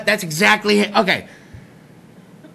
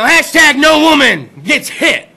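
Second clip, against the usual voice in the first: slogan; 5 semitones higher, 5 dB louder, slower than usual. Pretend. Mock slogan proclamation.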